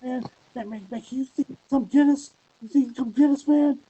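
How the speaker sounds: high, loud panicked prank caller. Retold or imitated. Friend pranking Drake on the phone, high and frantic. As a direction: fake stranded friend pleading high and loud. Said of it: "we prank called Drake".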